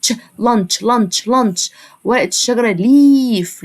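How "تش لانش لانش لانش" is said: لانش ending on a clear تش sound; ch in lunch. Shown as a demonstration. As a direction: end لانش with a crisp تش, not ش. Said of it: "انطقها تش".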